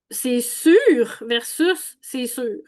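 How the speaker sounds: c'est sûr said very slowly, first with a tense U, then with a lax one; two U vowels. Shown as a demonstration. Teacher contrasting tense and relaxed U in c'est sûr, slowly.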